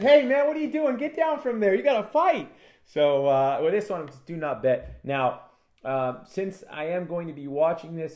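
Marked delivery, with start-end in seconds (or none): 0.0-2.5 high, loud incredulous referee yell; referee scolding. Retold or imitated. Referee Herb Dean ordering the fighter down, loud. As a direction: bark at him to get down. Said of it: "Herb Dean said".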